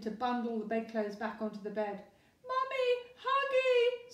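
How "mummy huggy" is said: put-on very high, loud baby squeal; screaming baby. A narrator's reading. Baby clinging to mum, high screaming. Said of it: "screamed the baby".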